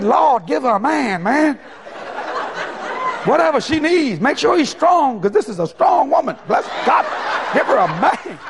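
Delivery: preacher loudly praying for the woman in a closet; loud prayer. Retold or imitated.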